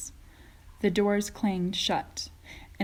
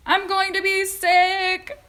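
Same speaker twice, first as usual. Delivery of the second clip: high, loud, slow nauseous wail; yelled; 12 semitones higher, 10 dB louder, slower than usual. A narrator's reading. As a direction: wail that you'll be sick. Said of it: "yelled Missus T V".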